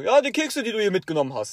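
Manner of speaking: loud, high telling-off; teacher scolds the student over crumbs, loud and high; scolding teacher. Retold or imitated. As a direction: tell him off about the crumbs. Teacher scolding student about cookies.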